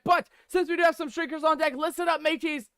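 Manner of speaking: rally the crew like a mock pirate captain. Captain persona addresses his crew.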